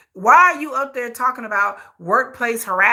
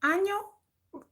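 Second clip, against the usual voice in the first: high, soft mock hello; high girlish answer; 12 semitones higher, 6 dB quieter than usual. Plays the woman answering.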